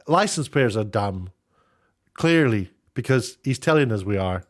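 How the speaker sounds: ordinary talk, nothing marked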